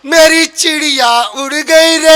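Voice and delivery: slow, sing-song granny wail; old-fashioned granny. Acted. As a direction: lament with a long-held final word. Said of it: "वही पुराने type की दादी". (पुरानी दादी का लंबा विलाप)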